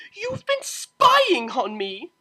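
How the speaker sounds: loud, slow, high outraged cry; outraged exclamation. Acted. Octavia discovering her mother spied on her, outraged. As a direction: accuse her furiously. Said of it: "she exclaimed".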